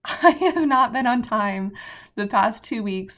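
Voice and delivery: normal talking voice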